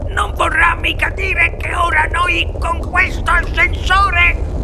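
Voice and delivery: high, slow, panicky grandfather; aghast, drawn-out high question; alarmed stammer. A narrator's reading. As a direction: force it out haltingly in high dread. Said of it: "balbettò nonno Joe".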